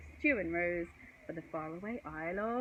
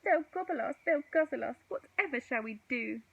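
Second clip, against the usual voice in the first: high, loud worried wail; mock panic, higher and louder; panicky; 7 semitones higher, 6 dB louder than usual. A narrator's reading. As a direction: fret loudly about being eaten. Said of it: "cried Stu".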